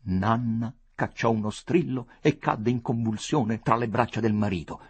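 usual speaking voice

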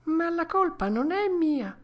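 put-on high puppet-boy whine; plaintive Pinocchio. A narrator's reading. Pinocchio blaming Lucignolo to the dormouse, high and plaintive. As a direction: protest like a child shifting the blame.

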